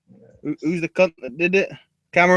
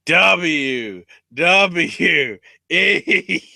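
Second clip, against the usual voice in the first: very slow, spaced-out initials; mock-deliberate slow spelling; drawn-out letters; 3 semitones higher, 4 dB louder, much slower than usual. Quoted interviewer spells out company.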